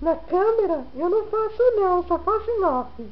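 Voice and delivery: high, babyish put-on voice; baby's voice. Parent answering as the camera-shy baby, high baby voice. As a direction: speak for a coy baby, high.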